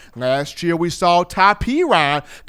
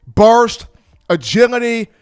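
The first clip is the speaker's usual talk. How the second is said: ordinary talk, nothing marked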